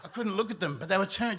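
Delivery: ordinary talk, nothing marked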